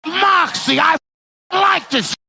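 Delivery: high, loud, drawn-out mock woman's voice; imitating a woman. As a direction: shout it high, mimicking her. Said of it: "she's like".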